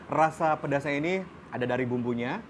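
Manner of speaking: ordinary delivery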